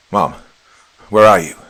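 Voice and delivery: screaming. A narrator's reading. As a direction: scream it through the house. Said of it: "I screamed".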